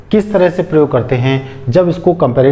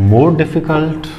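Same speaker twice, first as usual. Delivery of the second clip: "more difficult" stretched out very slowly; slow demo "more difficult"; much slower than usual. Shown as a demonstration. Teacher showing students the comparative, said very slowly. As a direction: spell out "more difficult" at a crawl for the class. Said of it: "ठीक इसी तरह से difficult".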